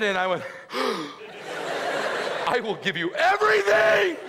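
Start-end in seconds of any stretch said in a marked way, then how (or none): 0.5-2.4 sharp acted intake of breath; imitated gasp of amazement; shocked gasp. Acting out his own shocked reaction. 2.5-4.2 high, loud hammed-up vow; mock-hysterical devotion, pitched way up; overwhelmed father. Acts overwhelmed father promising his baby.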